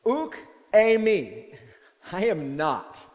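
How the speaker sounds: loud, slow "Uk eimi" breaking into a laugh; mock a flustered denial; defensive denial. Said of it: "He responds strongly to this question".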